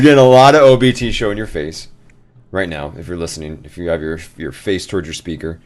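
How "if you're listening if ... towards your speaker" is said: normal talking voice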